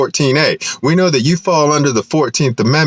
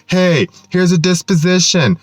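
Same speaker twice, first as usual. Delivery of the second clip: higher, offhand mock voice; mock court official; 7 semitones higher than usual. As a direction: lightly parody the court handing over papers. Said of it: "where they say".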